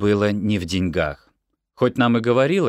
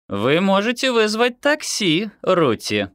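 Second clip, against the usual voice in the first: sugary, higher offer; sweet voice; 11 semitones higher than usual. A narrator's reading. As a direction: sweetly offer the taxi, knowing it will sting. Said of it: "сладким голосом предложила Лерас". (сладким голосом)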